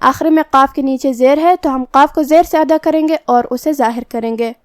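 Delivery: ordinary delivery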